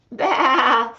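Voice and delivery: loud bah, a bit high; loud bleat. Acted. The sheep answers from under the mask, loud bleat. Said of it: "says the sheep".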